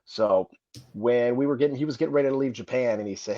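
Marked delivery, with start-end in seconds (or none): none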